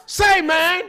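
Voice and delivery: high-pitched calling out of a name; comic holler. Pretend. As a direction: holler up at him in a squeezed high voice. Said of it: "I'm hollering at myself".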